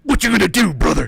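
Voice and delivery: loud, high wrestler shout; Hulk Hogan voice. Pretend. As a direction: roar it like a wrestling promo. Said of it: "hit him with the Hulk".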